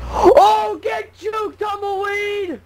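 high triumphant shout; excited taunt. Real, not acted. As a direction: crow over dodging it. Dodges tumbleweed, taunts it.

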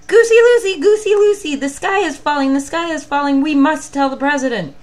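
very high, very loud panicked shout; frantic shrieking birds; yelled. Acted. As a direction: yell the alarm at the goose. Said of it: "and yelled".

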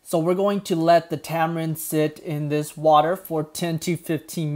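soft, quiet tutorial voiceover; calm voiceover narration. Acted. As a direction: narrate the steps calmly and quietly.

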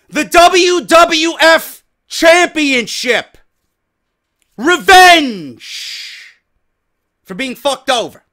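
slow, high, loud declaration; loud, slow, triumphant answer; emphatic shout. Real, not acted. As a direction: hammer the answer out. Answering his own question emphatically.

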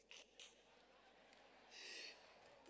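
breathy, nearly voiceless laugh; long soft breathy chuckle; re-enacted laughing. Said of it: "I started laughing".

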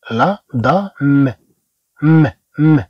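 دام slowly, closing on a bare m; M with sukun. Shown as a demonstration. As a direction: hum the final م with no vowel. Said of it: "وكأنه عندنا ميم مع سكون".

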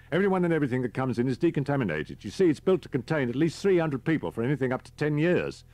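brief him like an efficient guide; briskly explaining driver. Acted. Voices Mason explaining the shelter.

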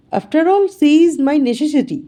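"my" pressed a little harder, said slowly; stress on my. A narrator's reading. Wife to husband in English, with "my" stressed. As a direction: lean on "my" in "she is my necessity". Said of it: "মাই শব্দটার ওপর একটু বেশি জোর দিল".

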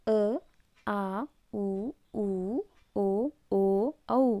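"ಅ, ಆ, ಉ, ಊ, ಓ, ಓ, ಔ" as separate vowel sounds of O; "ಅ, ಆ, ಉ, ಊ, ಓ, ಓ, ಔ", each vowel held apart; letter-sound demo. Shown as a demonstration. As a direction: sound out "ಅ, ಆ, ಉ, ಊ, ಓ, ಓ, ಔ" softly, one by one. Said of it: "ಅಕ್ಷರದಿಂದ ಪ್ರೊನೌನ್ಸ್ ಮಾಡ್ತೇವೆ".

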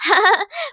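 high burst of laughter; a group cracking up, laughing high; quick rising laugh; friends laughing. Acted. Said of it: "เพื่อนเธอขำกลิ้งเลยล่ะค่ะ".

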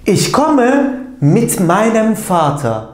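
slow, careful German example sentence; slow model sentence. Shown as a demonstration. Teacher to Turkish students reading the German example, slow and clear. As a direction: spell it out slowly for the class. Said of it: "cümleler içerisinde anlamlandırmaya çalışalım".

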